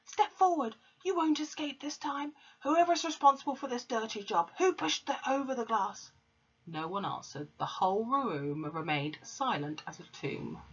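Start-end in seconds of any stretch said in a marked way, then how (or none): 0.0-6.1 hammed-up bully headmistress demanding a confession; Trunchbull roaring. A narrator's reading. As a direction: storm at the class as the angry headmistress. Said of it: "she roared".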